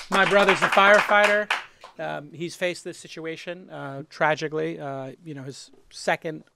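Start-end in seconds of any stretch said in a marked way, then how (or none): 2.0-6.5 quiet and sombre; hushed remembering of a loss; somber, quiet. Real, not acted. Brother's first fires included nine eleven.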